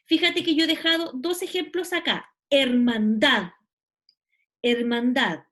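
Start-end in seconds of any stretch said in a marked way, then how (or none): 2.5-5.4 "Hermandad" said slowly, leaning on the final DAD; stress on last syllable. Shown as a demonstration. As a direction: stretch and punch the end of "Hermandad": erman-DAD. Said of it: "tiene su sílaba tónica en la última sílaba". ("Hermandad" con énfasis en la última sílaba)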